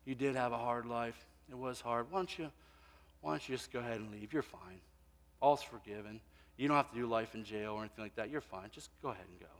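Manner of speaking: a judge mildly waving a killer out of court; mock lenient judge. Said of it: "the judge says".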